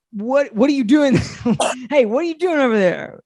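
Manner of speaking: mock boss. Friend mocking the boss catching him at the cabinet, loud and high. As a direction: bark it like a nosy boss.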